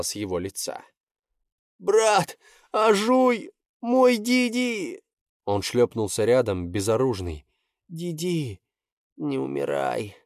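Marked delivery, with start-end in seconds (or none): 1.8-5.0 high, desperate cry for his brother; anguished cry. Acted. Brother sees sibling half-dead. 7.9-10.2 tearful plea. Acted. Pleading over dying brother.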